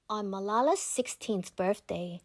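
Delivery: ordinary delivery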